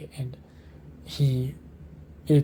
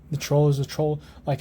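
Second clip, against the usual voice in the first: ordinary talk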